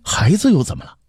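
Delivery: high-pitched defiant retort; stubborn child. Acted. Young prince answers his father's refusal, stubbornly. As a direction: talk back as a confident little boy. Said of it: "透出倔强和自信".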